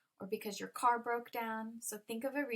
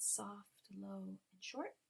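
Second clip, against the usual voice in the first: much softer, slightly lowered voice; soft, low, short; 3 semitones lower, 9 dB quieter, slower than usual. Shown as a demonstration. Teacher acting out a quiz option, soft and low. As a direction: say it small and quiet. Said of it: "how does a strongly stressed word sound".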